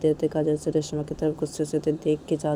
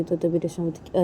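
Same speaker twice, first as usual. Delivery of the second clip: ordinary delivery, nothing marked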